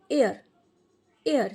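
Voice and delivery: "air" said as one r-controlled sound; "air" pronunciation. Shown as a demonstration. Teacher showing how e-r is read, "air" pronunciation. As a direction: demonstrate "air" as a single sound. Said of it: "इसको हम पढ़ेंगे".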